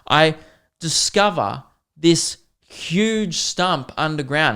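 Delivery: normal talking voice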